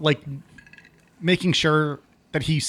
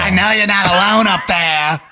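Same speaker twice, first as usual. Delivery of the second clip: loud, higher suspicious granny; grandma voice; 4 semitones higher, 7 dB louder than usual. Pretend. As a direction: accuse like a nosy grandma. Continuing grandma bit.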